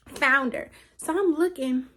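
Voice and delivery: usual speaking voice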